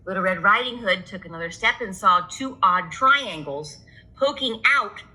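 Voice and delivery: ordinary delivery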